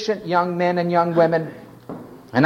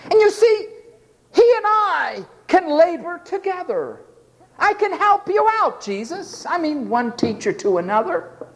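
high, mocking voice of a self-important helper; mock the proud would-be helper; smug helper; 12 semitones higher than usual.

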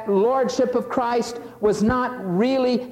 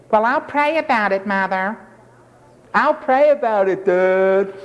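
a hint of a stalling teen, lightly voiced; mock whiny teenager. Preacher mimics teen stalling his mother and dad. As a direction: brush off the parents with a sing-song stall.

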